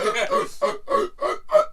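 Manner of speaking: high, loud choking grunts; repeated mock gagging bursts; mimed choking. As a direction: imitate choking on a mouthful. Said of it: "'cause I was like".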